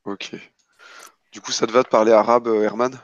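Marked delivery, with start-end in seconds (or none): none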